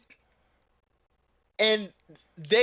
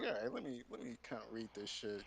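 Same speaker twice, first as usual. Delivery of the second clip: much softer, low grumble to himself; quiet low grumble; muttered aside; 8 semitones lower, 16 dB quieter, faster than usual. Retold or imitated. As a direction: say it under your breath.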